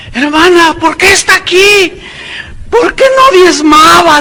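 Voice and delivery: voz aguda y fuerte de burla; pregunta burlona. Retold or imitated. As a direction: remedar la pregunta absurda del testimonio. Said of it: "Pregúntale tú a ella". (parodia aguda y fuerte de la pregunta a la hermana)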